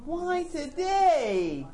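high, slow complaining wail; exasperated whine. Retold or imitated. Driver with a flat tire, slow high whining complaint. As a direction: drag it out in mock despair.

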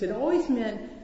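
ordinary talk

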